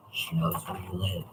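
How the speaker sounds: very low, hushed mock menace; creepy murmur. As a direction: drop low and quiet, playfully creepy. Creepy joke about Dora.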